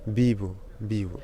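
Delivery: "bibo" with the written v said as b; initial V as B. Shown as a demonstration. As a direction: start "bibo" on a clear b sound. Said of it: "il se prononce B".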